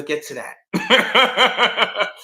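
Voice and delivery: drawn-out laugh through the words. Real, not acted. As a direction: let the laugh carry it. Laughing aside about Nation.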